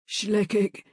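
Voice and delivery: weak dying croak. A narrator's reading. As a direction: croak the word out. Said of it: "he croaked".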